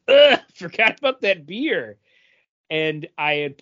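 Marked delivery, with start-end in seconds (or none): 0.1-2.0 remembering the beer. Retold or imitated. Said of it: "and then I went".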